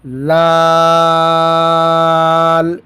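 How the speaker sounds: لال recited with a drawn-out madd; long vowel. Shown as a demonstration. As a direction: stretch the vowel in لال slowly. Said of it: "اس پہ بھی مد ہے، اس کو بھی ہم لمبا کریں گے".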